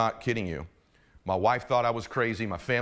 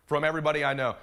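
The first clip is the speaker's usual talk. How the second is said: ordinary talk, nothing marked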